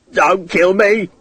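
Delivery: high, strained character cry; panicked shout. A narrator's reading. Condemned man to his armed enemy, high pleading shout. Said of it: "he shouted".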